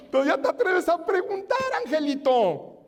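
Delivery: high-pitched sarcastic put-down; mock incredulity. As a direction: taunt them as if amazed at their nerve. God mockingly answers the complainers.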